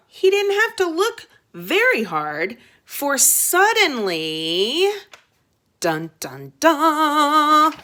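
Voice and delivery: slow, dramatic dun dun dun; suspense build. A narrator's reading. Reader building suspense for kids, slow dramatic dun dun dun. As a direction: ham up the suspense.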